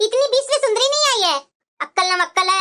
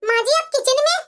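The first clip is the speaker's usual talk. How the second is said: ordinary talk, nothing marked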